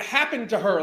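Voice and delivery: usual speaking voice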